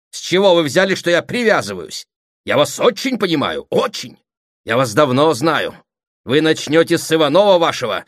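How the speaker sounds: loud, high, escalating challenge; angry quarrelling. Acted. Brother and sister disputing old debts, angry quarrelling. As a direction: argue heatedly, voice climbing.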